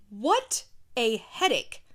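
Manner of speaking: ordinary talk, nothing marked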